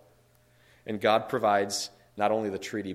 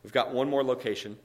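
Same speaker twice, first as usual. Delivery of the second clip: usual speaking voice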